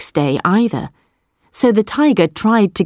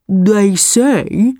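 slow buffalo; 2 semitones higher than usual. Acted. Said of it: "said the buffalo slowly".

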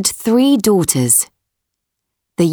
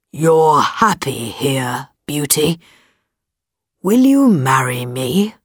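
deep distorted monster voice; 5 semitones lower than usual. A narrator's reading. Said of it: "he said".

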